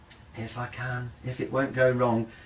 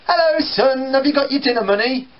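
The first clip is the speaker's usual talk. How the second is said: higher, louder, quick mum voice; mum's greeting; 10 semitones higher, 4 dB louder than usual. Retold or imitated. Mum back from night shift greeting her son, higher. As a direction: breeze in and ask about money.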